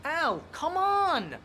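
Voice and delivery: loud, slow, exasperated plea; exasperated cry. Acted. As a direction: drag it out in frustration. Exasperated plea to disbelieving friend.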